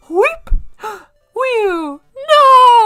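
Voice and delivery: high, loud, crying out in mock rapture; hammed-up ecstatic wail; imitated overjoyed cry. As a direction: wail with overwhelmed joy. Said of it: "And he'd be like".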